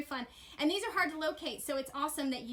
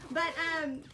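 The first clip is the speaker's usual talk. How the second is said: ordinary talk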